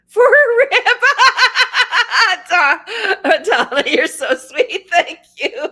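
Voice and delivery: moved to tears-laughter by a kind gift; laughing through tears. Real, not acted. Touched by viewer's rib gift.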